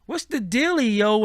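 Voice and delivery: fake hip-hop slang. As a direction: lampoon a girl trying too hard to sound street. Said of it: "Katie is on some".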